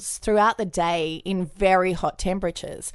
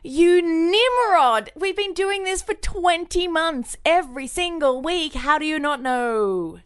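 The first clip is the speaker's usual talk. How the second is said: exasperated scolding; 8 semitones higher than usual. Real, not acted. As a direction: scold him in disbelief. Mock scolding cohost.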